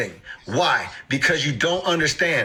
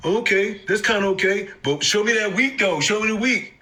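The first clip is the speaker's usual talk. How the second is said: higher, impatient mock demand; mock demanding investor; 4 semitones higher than usual. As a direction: act the impatient holder. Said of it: "Here's what they've done for the day".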